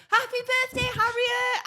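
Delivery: high-pitched imitation of a cheery client; gleeful birthday greeting. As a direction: greet her in a high, chirpy mock voice.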